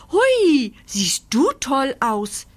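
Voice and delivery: hoch einsetzendes, fröhliches Hui; fröhliches Kläffen. A narrator's reading. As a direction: freudig bellend loben. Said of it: "kläfft Stups fröhlich". (fröhlich kläffend)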